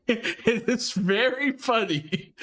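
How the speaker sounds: laughing through the words, slow; cracking up as he says it's funny. Real, not acted. Streamer laughing about a funny video, laughing.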